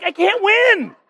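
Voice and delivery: high-pitched mock exasperation; exasperated protest. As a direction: throw up a helpless, high protest to your wife. Retold exasperated protest to wife.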